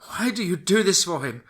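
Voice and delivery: high, loud anguished scream; desperate scream. Acted. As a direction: scream it at her in despair. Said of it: "screamed Lizzie".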